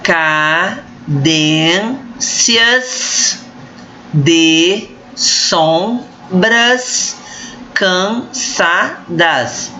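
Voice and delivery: drawn-out scansion, syllable by syllable; slow syllable by syllable. Shown as a demonstration. Teacher scanning a verse for students, slow and syllabic. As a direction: split the verse into slow beats. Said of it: "Então vamos lá".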